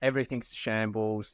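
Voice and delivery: ordinary talk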